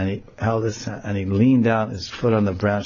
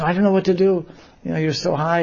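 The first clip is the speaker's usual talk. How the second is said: put-on high, fretful girl voices; high anxious gopis; helpless friends; 8 semitones higher than usual. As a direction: throw up your hands, worried.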